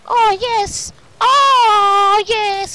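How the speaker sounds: high, loud, drawn-out exulting; mounting delight. Real, not acted. Gamer delighting in the game, building exclamation. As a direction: build to a long loud cry of pleasure.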